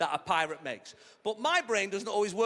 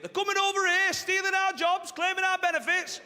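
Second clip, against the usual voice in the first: high, loud mock rant; right-wing ranter; 11 semitones higher, 4 dB louder than usual. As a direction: imitate an indignant far-right complainer, pitched way up. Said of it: "people all the way on the right giving it".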